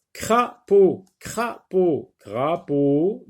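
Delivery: "crapaud" chopped as cra, paud; syllable by syllable. Shown as a demonstration. Teacher tapping syllables for pupils, "crapaud" broken into cra... paud. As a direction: tap out "crapaud" in two distinct beats. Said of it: "frappe les syllabes".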